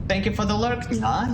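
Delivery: cutesy nya speak. Pretend. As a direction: chirp it like a playful cat. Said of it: "me acting like a cat".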